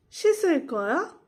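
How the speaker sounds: 씻을 거야 with a high rise at the end; question intonation. Shown as a demonstration. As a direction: ask 씻을 거야, lifting the last syllable. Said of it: "read as questions".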